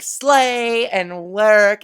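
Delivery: loud, slow, drawn-out catchphrases; sassy slang inflection. Shown as a demonstration. Host demonstrating the inflection being practised, sassy slang inflection. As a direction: stretch each slang word with mock sass. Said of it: "whatever makes you say, like, things".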